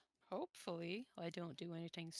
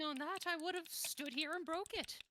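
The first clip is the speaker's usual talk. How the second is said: excited brag; 9 semitones higher than usual. Acted. Boasting about breaking the record.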